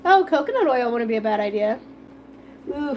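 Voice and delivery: ordinary delivery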